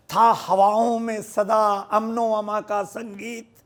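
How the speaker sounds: ringing Urdu verse declamation, easing at the refrain; poetry recitation. Acted. Poet recites to the gathering after asking them to listen, ringing declamation. Recites his nazm to an audience.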